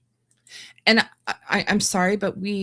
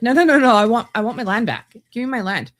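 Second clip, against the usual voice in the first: high, loud mocking voice demanding land; mock whiny demand; 6 semitones higher, 8 dB louder, faster than usual. Vlogger mocking claimants demanding their land back, high and loud. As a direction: whine it out like a greedy claimant.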